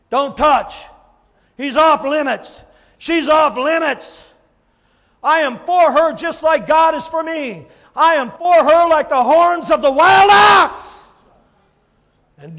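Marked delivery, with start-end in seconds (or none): 0.1-10.8 loud, high, driving sermon delivery; shouting revival preacher building to a climax; full-force preaching. Acted. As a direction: preach it out to the whole congregation.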